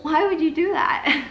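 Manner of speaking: can't believe she did that; exasperated. Real, not acted. Speaker reacting to teacher's choice.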